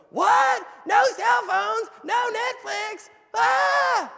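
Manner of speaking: high, loud pretend shriek of protest; hammed-up shouting children; mock kids' outcry. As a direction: wail like spoiled kids losing Netflix. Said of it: "my kids didn't go".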